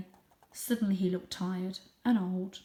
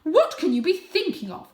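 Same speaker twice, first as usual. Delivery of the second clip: high, loud disbelief; can't believe him; appalled mother; 10 semitones higher, 10 dB louder than usual. Acted.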